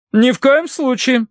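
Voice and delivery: slow, very high matronly veto; firm interruption. A narrator's reading. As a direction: cut in and forbid it outright. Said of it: "вмешалась миссис Меринг". (категорично, высоким голосом)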